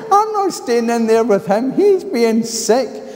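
high, loud mock drunk; drunk man complaining. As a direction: whine it like a fussy drunk. Drunk man's complaint quoted.

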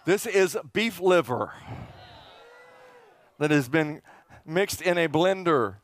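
usual speaking voice

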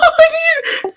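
pained laugh. Real, not acted. Laughs after getting hit.

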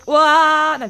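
sudden loud startled cry. Real, not acted. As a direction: blurt out in shock. Sudden game event.

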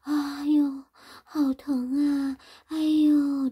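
high, drawn-out moans of pain; groaning in pain. Shown as a demonstration. Teacher demonstrating what groaning means, moaning in pain. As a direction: moan as if hurting badly. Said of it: "呻吟的意思就是".

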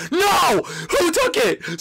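very high, loud wail of dismay; anguished shout. Real, not acted. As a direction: cry out at the loss. Someone took the prize.

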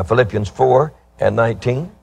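usual speaking voice

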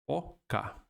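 usual speaking voice

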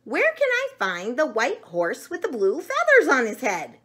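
loud, high, insistent asking; screamed question. A narrator's reading. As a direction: demand it as an agitated storybook chicken. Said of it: "screamed Chicken Chuck".

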